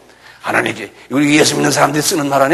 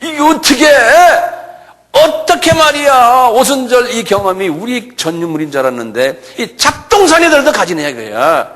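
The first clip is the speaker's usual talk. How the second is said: mock indignant pride; 8 semitones higher than usual. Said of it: "똑같은 소리".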